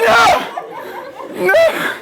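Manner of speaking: hammed-up shriek of a helpless dad; acted panicked yell. Dad watching son gun the car, mock panicked screaming.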